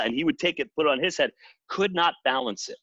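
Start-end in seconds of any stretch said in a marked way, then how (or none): none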